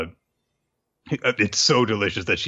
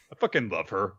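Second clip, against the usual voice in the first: ordinary delivery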